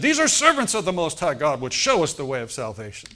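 loud, insistent proclamation at the top of the voice; crying out loudly. Retold or imitated. Possessed girl crying out after Paul, loud proclamation. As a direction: announce it like blowing a trumpet. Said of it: "followed Paul everywhere he went and said".

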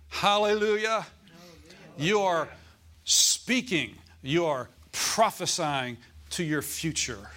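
loud, high hallelujah and proclamation; preaching shout. Acted. Pastor to congregation, shouting praise. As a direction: lift the room with it.

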